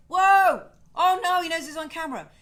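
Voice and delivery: excited. Real, not acted. Showing off the dog on camera.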